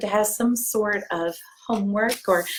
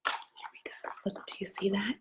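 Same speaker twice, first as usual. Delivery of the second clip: softer whisper, pointing something out; 12 dB quieter than usual. Acted. Reader whispering to children about the picture. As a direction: lean in and whisper the discovery.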